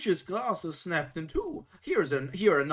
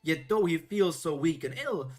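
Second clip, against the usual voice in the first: ordinary delivery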